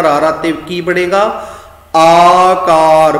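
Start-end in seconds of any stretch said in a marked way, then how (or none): 2.0-3.2 ਆਕਾਰ very slow and loud, both aa vowels held; open long vowels. Shown as a demonstration. Teacher showing how to read ਆਕਾਰ, long open first aa. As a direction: open the mouth fully on ਆਕਾਰ, stretching the first aa. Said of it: "ਪੂਰਾ ਮੂੰਹ ਖੋਲ ਕੇ ਬੋਲਣਾ ਪਏਗਾ".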